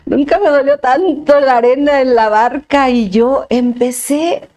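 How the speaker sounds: hammed-up retold weeping fit, no real tears; dramatic lament. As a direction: stretch the words in comic anguish. Dramatizing her sudden crying fit.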